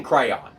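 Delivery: "crayon" as CRAY-ON; extra syllable. Shown as a demonstration. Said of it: "You are adding an extra syllable".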